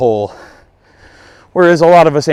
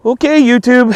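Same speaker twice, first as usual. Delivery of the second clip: laughing; 10 semitones higher, 4 dB louder than usual. Real, not acted. Laughing after missed putt.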